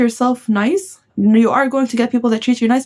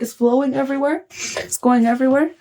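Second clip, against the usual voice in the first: normal talking voice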